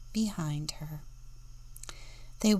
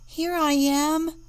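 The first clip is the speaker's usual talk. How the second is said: cheerful answer; 9 semitones higher, 5 dB louder than usual. A narrator's reading. Louise answers her sister.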